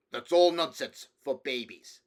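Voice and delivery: put-on stern king cutting the child off. A narrator's reading. King dismissing his nephew's fairy stories, stern. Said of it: "said the king sternly".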